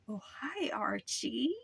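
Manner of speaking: eerie whispered greeting, sing-song; creepy whisper. Retold or imitated. Naked neighbor greets Archie in a creepy whisper. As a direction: breathe it like a spooky old woman.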